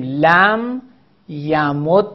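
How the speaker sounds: یمد said slowly as ya-mud-da; لم یمد, measured, final fatha; merged with fatha. Shown as a demonstration. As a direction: hold the doubled d in یمد, ending on a.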